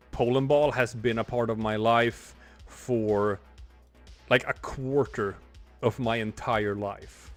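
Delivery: normal talking voice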